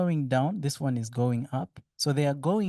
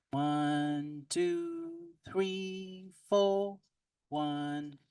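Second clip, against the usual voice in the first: metallic voice filter; 5 semitones higher, 3 dB quieter, much slower than usual. Shown as a demonstration. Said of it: "Hear how bad it sounds?".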